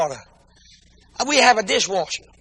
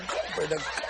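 laughing mock praise; 6 semitones lower, 14 dB quieter than usual. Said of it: "I said".

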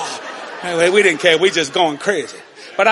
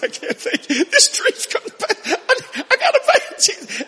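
ecstatic praise; 13 semitones higher than usual. Retold or imitated. Overjoyed shouting to Jesus in van.

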